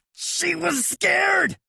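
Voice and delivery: very high, slow yelled plea. A narrator's reading. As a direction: yell it to get through. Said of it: "he yells".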